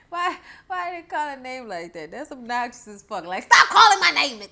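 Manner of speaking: ordinary talk, nothing marked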